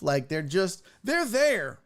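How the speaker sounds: ordinary talk, nothing marked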